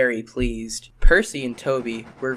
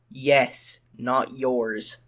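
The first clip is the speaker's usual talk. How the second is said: plain reading voice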